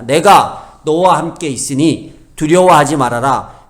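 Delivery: slow, loud, solemn divine promise; voice of God. Retold or imitated. Preacher voices God reassuring Isaiah, slow and loud. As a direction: proclaim it like God steadying a frightened prophet. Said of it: "이사야에게 이런 얘기를 합니다".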